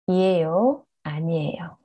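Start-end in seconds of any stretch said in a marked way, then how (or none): 1.1-1.8 아니에요 breathed out as a soft whisper. Shown as a demonstration. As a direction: let 아니에요 fade into a whisper. Reading drill, last word trails.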